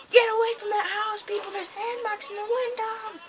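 loud, high-pitched alarmed call; mock warning shout. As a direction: holler a playful warning to people in danger. Calls warning to game's people.

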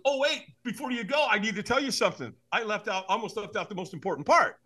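high, excited catch-you-before-you-go voice; urgent afterthought. Retold or imitated. Buddy stopping his friend from hanging up, excited. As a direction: act out a friend who just remembered.

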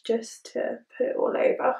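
unvoiced murmur; whispering. Real, not acted. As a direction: whisper it under your breath. Murmured aside while applying.

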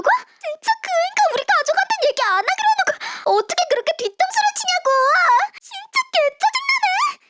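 high, fast angry outburst; furious rant. Acted. Daughter raging that her ex betrayed her, high angry rant. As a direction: spit out the rage, pitched high.

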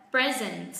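"present" as PRE-zent, second syllable falling; stress on first syllable. Shown as a demonstration. Teacher models the noun or adjective, first-syllable stress on "present". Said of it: "Chúng ta hạ giọng âm tiết thứ hai".

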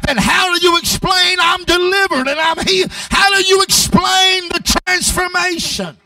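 loud, high shouting sermon; preaching at full force. Real, not acted. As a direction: shout it to the rafters like a revival preacher.

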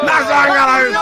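high, loud mocking parrot; mocking imitation. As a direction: repeat it back in a high squeaky voice. Streamer mocks the clip line.

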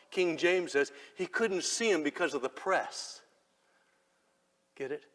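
ordinary delivery

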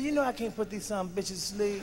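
very soft imitated wife; quiet, private acted reply; hushed objection. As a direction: object under your breath. Retold wife's hushed answer.

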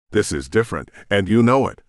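usual speaking voice

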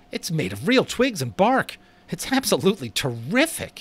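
high, eager, amazed voice; awestruck admiration. A narrator's reading. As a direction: gush with wonder at it. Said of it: "with such awestruck admiration in his voice".

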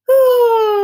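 a high, loud sung ooh gliding down. Shown as a demonstration. Teacher's butterfly path, high voice sliding down. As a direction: float way up, then let it fall to the flower. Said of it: "let's try that one again".

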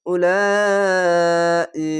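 উলাই held out very long on one note; উলাই sustained slowly; long drawn vowel. Shown as a demonstration. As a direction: stretch উলাই as in Quran recitation. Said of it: "চার আলিফ টেনে পড়বেন".

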